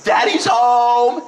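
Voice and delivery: high, very slow, drawn-out happy call; a little kid calling out as dad opens the door; child's excited call. Retold or imitated. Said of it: "and I hear".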